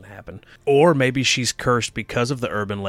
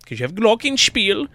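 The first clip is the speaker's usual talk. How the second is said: pitched-up mock German accent; high fake German; 10 semitones higher, 4 dB louder than usual. Joking about Glockenspiel in a high mock German accent. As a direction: Americans faking German, high. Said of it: "talk really high-pitched".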